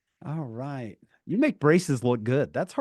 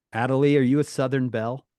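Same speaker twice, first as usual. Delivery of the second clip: usual speaking voice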